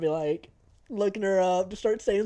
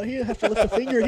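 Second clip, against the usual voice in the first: ordinary delivery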